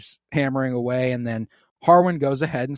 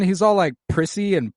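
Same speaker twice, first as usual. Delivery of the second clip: ordinary talk, nothing marked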